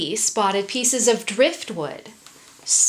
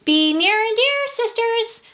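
calling out; 12 semitones higher, 3 dB louder than usual. A narrator's reading. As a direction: call out to your sisters in a high voice. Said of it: "she hung up a hammock and called out".

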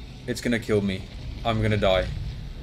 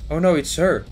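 ordinary talk, nothing marked

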